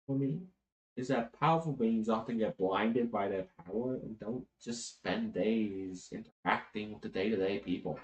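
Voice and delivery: soft, measured, earnest speech; hushed, grave immortal confiding a lesson; solemn archangel. Acted. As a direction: explain a sacred duty gently, with pauses.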